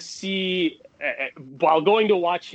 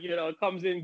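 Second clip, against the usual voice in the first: usual speaking voice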